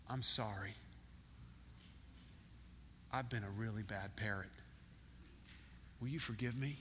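low, soft, hesitant apology with long pauses; contrite parrot. Retold or imitated. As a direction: act out a humbled pet begging pardon. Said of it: "got his head down like this".

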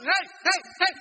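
high, loud mock outcry; hammed-up passenger outrage; indignant protest. Protesting the plane turning around.

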